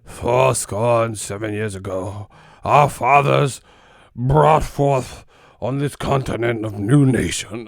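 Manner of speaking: put-on slow, declaimed old-time speech; imitated old-fashioned oratory, drawn out; mock Gettysburg orator. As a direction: proclaim it grandly like an antique statesman. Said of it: "reading the Gettysburg Address".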